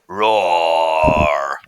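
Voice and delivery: low, loud, drawn-out roar; loud bear roar. Acted. Sound effect played back for the class, loud low roar. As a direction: give a big hungry-bear roar.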